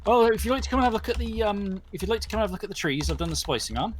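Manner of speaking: quick, cheerful offer to show the trees; a lightly voiced Albert rattling on, inviting a look; chatty old handyman. Albert fetching the wolves, quick and eager.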